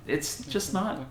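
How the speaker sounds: normal talking voice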